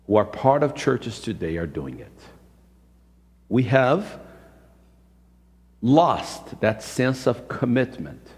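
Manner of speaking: usual speaking voice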